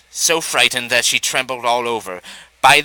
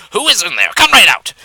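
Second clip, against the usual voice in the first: loud, higher challenge shouted into the den; calling out; 6 semitones higher, 6 dB louder than usual. A narrator's reading. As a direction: call the goat out bravely.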